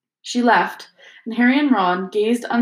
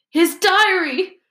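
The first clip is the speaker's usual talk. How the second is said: much higher, slow, voiced girl's sob; sobbing; 11 semitones higher, 4 dB louder, slower than usual. Ginny confessing to her parents, sobbing. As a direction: blurt it out through tears, higher. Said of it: "Ginny sobbed".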